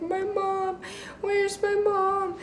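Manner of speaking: high, slow, whimpering plea for mom; imitating tearful self. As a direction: whine it like a dazed kid crying for mom. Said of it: "I start bawling my eyes out".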